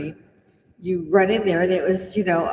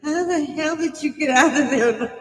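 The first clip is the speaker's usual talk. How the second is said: higher, tearful mock wail of a gassed officer; teary officer; 8 semitones higher than usual. Gassed officer asks how I got out, mock tearful and higher. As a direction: imitate a weepy officer in disbelief. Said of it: "looking over at me all teary-eyed and going".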